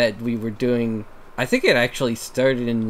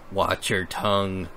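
ordinary delivery

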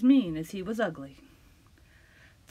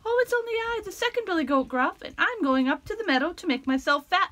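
higher, a less small goat voice; a bit bolder than the tiny goat; medium goat voice; 8 semitones higher than usual. Acted. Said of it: "his voice was not so small".

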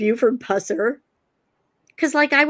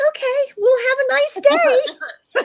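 very high, drawn-out mock-cheery farewell; awkward sign-off; 13 semitones higher, slower than usual. Woman escaping an awkward roadside chat, squeakily over-polite. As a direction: back out with a squeaky, embarrassed goodbye. Awkward retreat from the old man.

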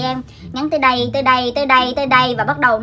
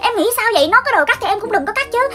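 mother ranting; 6 semitones higher than usual. Retold or imitated. Mother scolds the hairdresser over the haircut, high outraged rant. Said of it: "Má tôi la làng quá trời luôn".

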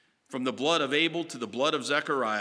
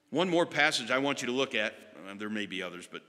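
ordinary talk

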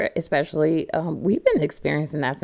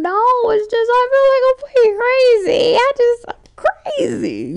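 tearful outburst; 7 dB louder than usual. Acted. Describes sudden crying spells.

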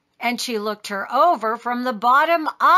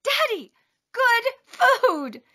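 put-on high, eager little-girl voice; eager child; 12 semitones higher, slower than usual. A narrator's reading. Taya shows her father chocolate, eager. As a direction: pipe it up high to win Daddy over.

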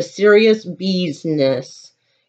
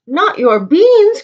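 higher, louder outraged cry; 9 semitones higher, 4 dB louder than usual. Acted. The dog hears the bandit steals beans, outraged cry. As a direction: protest in shock at the theft. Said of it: "cried Scipito, outraged".

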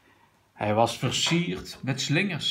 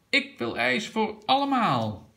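high, exuberant call; 9 semitones higher than usual. A narrator's reading. Grandpa orders at the ice cream parlour, calling out. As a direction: announce the treat in a raised pitch. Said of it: "riep opa".

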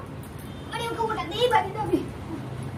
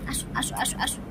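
whispered coaxing; 5 semitones lower, 9 dB quieter than usual. Real, not acted. Softly calling pet. (ফিসফিস করে ডাকা)

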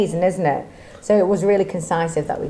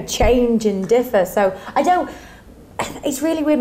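ordinary talk

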